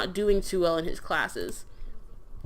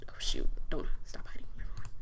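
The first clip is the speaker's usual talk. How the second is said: unvoiced whisper under the breath; hushed, breath-only muttering; whispered aside; 9 semitones lower, 5 dB quieter, faster than usual. Real, not acted. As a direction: whisper it to yourself. Muttered aside during doll play.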